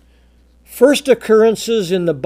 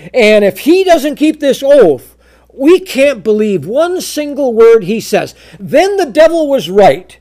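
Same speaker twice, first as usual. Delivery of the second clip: preaching loud; 5 semitones higher, 6 dB louder than usual. Acted. Preacher's climax on broken oath.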